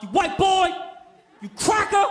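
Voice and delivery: high, loud mock tirade; going off. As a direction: hurl it like an insult across a train car. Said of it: "The dude's going off".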